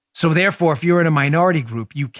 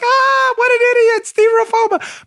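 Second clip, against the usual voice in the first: mocking laughter; 4 dB louder than usual. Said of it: "Everybody was like".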